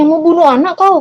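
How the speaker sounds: high-pitched, outraged challenge; imitated alarmed mother. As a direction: act out a mother confronting him. Mother confronts father holding machete.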